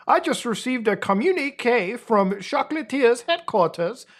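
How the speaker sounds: high, hammed-up public address; president's speech. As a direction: proclaim it like a pompous statesman.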